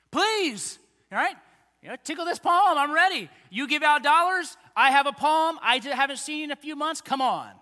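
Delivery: put-on loud, high, pleading kid begging for cash; greedy eager grandkid. Pastor mocking a grandkid at Grandpa's, loud eager begging. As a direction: beg like a kid holding out his palm.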